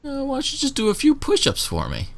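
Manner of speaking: high, teasing guard voice; flirty guard request. Acted. Guard flirting with Johnson, asking for push-ups, high and teasing. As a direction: tease him into showing off.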